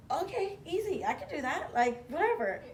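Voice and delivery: high, airy, mock-casual; telling the boss it's easy, mock-cocky and high; breezy cockiness. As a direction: toss it off like it's nothing.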